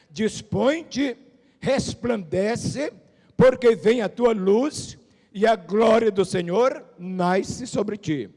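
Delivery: slow, lifted scripture reading. Acted. Pastor reading Isaiah to the church, slow and solemn. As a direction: stretch each word of the verse. Reads Isaiah verse slowly.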